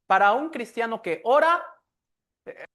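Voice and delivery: ordinary talk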